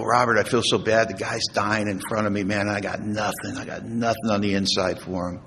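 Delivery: low-key, softened confession of emptiness; retold dejection. Man confides in his sponsor about the dying man, quietly dejected. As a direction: admit defeat quietly, voice sinking.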